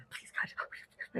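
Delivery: hushed, voiceless mock prayer; whispered plea. Friend braced for the needle prays, whispering. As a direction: imitate a desperate prayer under the breath.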